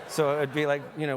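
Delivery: ordinary delivery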